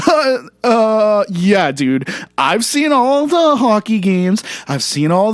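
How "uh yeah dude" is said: ordinary talk, nothing marked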